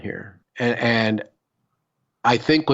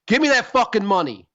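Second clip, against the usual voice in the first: a pitched-up mugger barking for cash; lightly acted stick-up demand, higher; mock robber demand; 9 semitones higher than usual. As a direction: play the gunman demanding money, pitched high. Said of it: "They're like".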